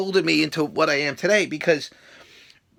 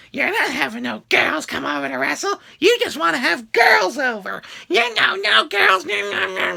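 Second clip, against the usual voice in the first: high put-on voice refusing, mock stern; 9 semitones higher than usual. Telling fans no girls, in a high mock voice. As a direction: play a mock disapproving parent.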